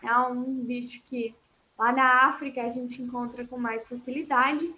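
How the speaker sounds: usual speaking voice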